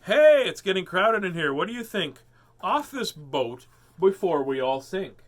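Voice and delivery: animated voice of a boat passenger; character voice. Acted. Character line from book, repeated.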